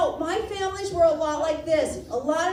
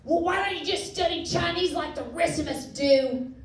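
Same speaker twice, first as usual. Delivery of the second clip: smoker dad impression; 3 semitones higher than usual. Smoker dad advising daughter on Chinese, imitated. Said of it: "He smoked. He was like".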